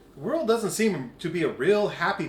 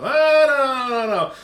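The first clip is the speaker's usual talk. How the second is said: loud, high no no no; loud rejection; 7 semitones higher, 10 dB louder than usual. Retold or imitated. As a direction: reject the stilettos loudly, higher. Friend rejects stilettos.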